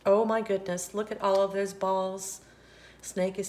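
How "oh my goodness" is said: usual speaking voice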